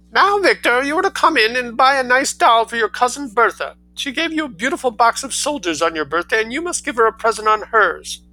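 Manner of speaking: high, loud, hurried bossy mother; penetrating mother. Acted. Mother ordering her son about, high and penetrating. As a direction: lecture the boy in a high, penetrating rush. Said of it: "a penetrating and rather hurried manner of talking".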